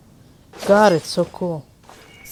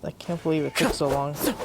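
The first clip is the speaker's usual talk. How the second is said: normal talking voice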